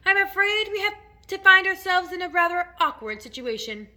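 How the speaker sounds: high, loud, composed announcement; fear held back. A narrator's reading. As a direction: break bad news while masking fear. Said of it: "trying to keep very hard from the fear and disappointment out of his voice".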